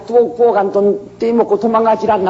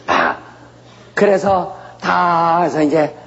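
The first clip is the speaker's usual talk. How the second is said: ordinary talk, nothing marked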